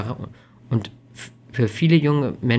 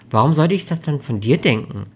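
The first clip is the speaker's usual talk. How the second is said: usual speaking voice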